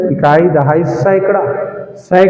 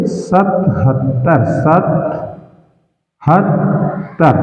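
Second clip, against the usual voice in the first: सतहत्तर spelled out slowly and a bit softer; slow careful; 2 semitones lower, 3 dB quieter, much slower than usual. Shown as a demonstration. Teacher reads सतहत्तर slowly so children hear each syllable. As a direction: draw out सतहत्तर for the class. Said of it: "इसको पढ़ते हैं".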